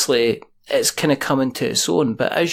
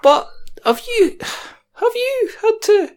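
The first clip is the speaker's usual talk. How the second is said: much higher, louder joke voice; up an octave; 3 dB louder than usual. Shown as a demonstration. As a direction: squeak it up an octave. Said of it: "we're all gonna go up an octave".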